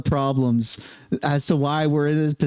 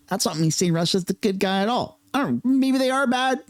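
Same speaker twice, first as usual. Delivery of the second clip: normal talking voice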